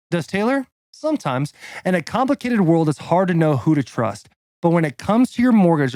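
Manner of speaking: punchy, deliberate announcer-style read; sponsor ad read. Acted. Podcast guest reading a mortgage ad, punchy and deliberate. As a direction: sell it line by line, stressing key words.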